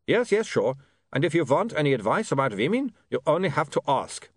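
put-on polite, reassuring vampire with a foreign accent; courteous vampire Otto. Otto thanks William for the job, courteous vampire voice.

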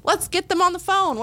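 excited. Retold or imitated. Coach at dinner thinking the principal will join, cheerful excitement. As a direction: sound delighted the boss is calling.

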